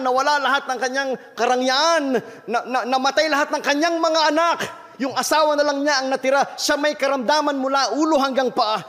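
high, pressing sermon voice building through Job's losses; impassioned preaching. Acted. Pastor retells Job's trials to the church, preaching high and impassioned. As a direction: preach the story of Job with rising force. Retells Job at full force.

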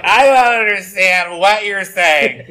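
put-on slow, high, slurred drunk voice; lightly voiced drunk, slowed way down. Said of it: "I was doing the drunk voice".

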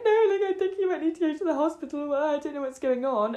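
put-on high-pitched, rushed drunken pleading; mock distressed. Drunk woman begging strangers about hospital, mock distressed. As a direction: act out your helpless, weepy self. Tearful drunk self asking girls for help.

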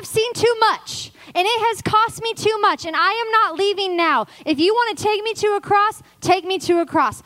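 high, loud, impassioned declaration; full-force preaching. Acted. Preacher declaring she is not leaving now, impassioned. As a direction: preach it with defiant resolve.

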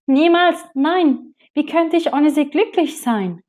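high, loud, outraged no; appalled refusal. Acted. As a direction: refuse it with all your might.

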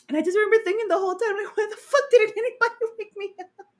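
high, tearful laughter over the words; crying with laughter. Real, not acted. Describing the new class in the room, crying with laughter. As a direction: squeeze the words out through sobbing laughs. Retelling the wake-up, still laughing.

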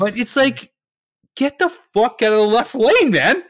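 high, loud exasperated yell; shouted road-rage outburst; ranting at drivers. Real, not acted. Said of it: "I'm clearly upset about this".

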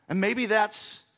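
ordinary talk

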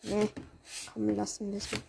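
ordinary talk, nothing marked

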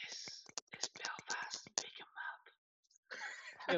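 breathy unvoiced whisper. Shown as a demonstration. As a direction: whisper it like an ASMR video. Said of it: "it's like a wee whisper".

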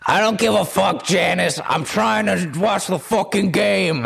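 high, put-on irritated husband voice; hammed-up old-couple bickering; cranky old husband. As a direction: holler back at your wife, annoyed. Old husband snapping back at wife.

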